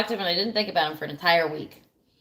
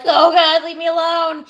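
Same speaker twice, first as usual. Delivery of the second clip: higher and louder, begging to be left alone; a frantic cry to the phone; desperate plea; 7 semitones higher, 7 dB louder, slower than usual. Retold or imitated. He keeps messaging and calling, a loud frantic plea.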